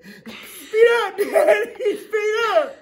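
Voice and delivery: put-on high, loud kid begging; excited kid. Little kid on dad's motorcycle, high excited squeal. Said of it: "and I said".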